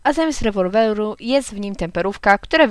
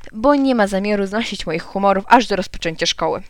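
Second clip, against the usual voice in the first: plain reading voice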